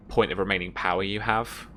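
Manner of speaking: ordinary delivery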